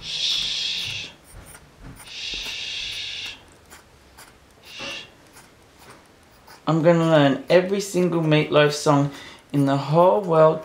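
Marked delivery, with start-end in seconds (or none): 0.0-5.1 whispered shh to settle a puppy; soft unvoiced shushing; hushed shushing. Real, not acted. Whispering to a sleepy puppy in bed, hushed.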